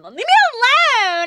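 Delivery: high, loud shrieking woman voice; Megan impression. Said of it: "a Megan impression".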